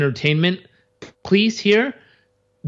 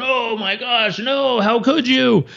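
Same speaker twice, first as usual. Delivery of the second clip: mock dismay; 8 semitones higher, slower than usual. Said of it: "crying and laughing and going".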